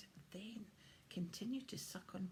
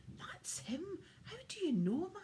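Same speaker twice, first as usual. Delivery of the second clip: high, louder startled question; alarmed child voice; startled boy; 11 semitones higher, 5 dB louder than usual. Acted. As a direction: gasp it out amazed. William startled, recognizing the hunter.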